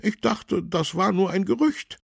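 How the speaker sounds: very high, loud voice of disbelief; amazed, pitched right up; astonished councillor. A narrator's reading. Councillor doubts what policeman says, astonished. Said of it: "fragte Fabian Pix erstaunt".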